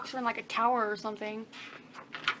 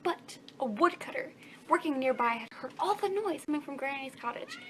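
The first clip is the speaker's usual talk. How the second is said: usual speaking voice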